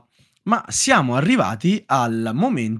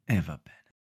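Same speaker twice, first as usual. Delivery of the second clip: much softer, deflated; resigned sigh; 9 semitones lower, 13 dB quieter than usual. Shown as a demonstration. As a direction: let it fade out in resignation.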